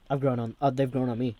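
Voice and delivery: ordinary talk, nothing marked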